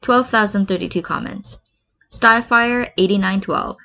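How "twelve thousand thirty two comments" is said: usual speaking voice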